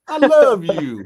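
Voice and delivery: high, loud gushing I love you; hammed-up flattery. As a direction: gush it up high and loud like a hustler. Don King flattering boxer retold.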